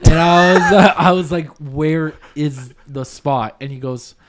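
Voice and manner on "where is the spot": very slow, suspicious asking; slow, measured mock suspicion; wary, drawn-out question. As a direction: stretch out each word warily.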